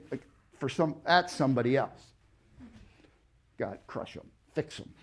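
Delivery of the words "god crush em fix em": hushed grudging prayer; whispered mock prayer. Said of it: "we wanna pray".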